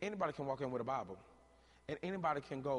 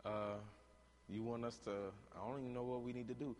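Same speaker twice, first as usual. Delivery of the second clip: mock hopeless doctor; 5 semitones lower, 8 dB quieter than usual. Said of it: "and he said".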